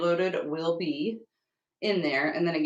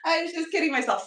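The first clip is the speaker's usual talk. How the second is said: higher, louder, rueful self-mockery; mock dramatic; 10 semitones higher, 3 dB louder than usual. Streamer laughing at her snow forecast, mock dramatic. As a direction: ham up the joke at her own expense.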